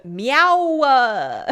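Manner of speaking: slow, loud whiny meow; cat whining for something; whiny Valley Girl meow. Retold or imitated. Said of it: "a Valley Girl meow".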